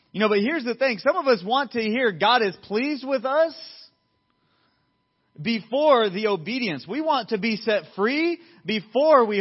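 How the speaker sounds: loud, high-pitched pulpit delivery; impassioned preaching. Real, not acted. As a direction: drive the point home to the congregation.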